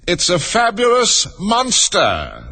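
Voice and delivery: high, drawn-out announcement; high-pitched unicorn exclamation; crying out. A narrator's reading. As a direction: proclaim it high, stretching every word. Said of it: "the unicorn cried out".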